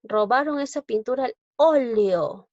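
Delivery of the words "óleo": "óleo" with its first Ó punched loud and long; teacher's stretched "óleo", stressed first syllable. Shown as a demonstration. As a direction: hit the opening Ó of "óleo". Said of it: "mayor fuerza de voz en la O".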